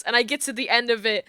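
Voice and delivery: ordinary delivery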